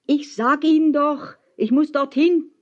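high, insistent call; hinaufgerufen. A narrator's reading. Calling up to man on ship. (mit hoher Stimme beharrlich rufen)

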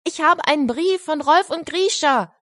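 put-on high, excited boy calling to everyone; calling out loud. Rief er laut.

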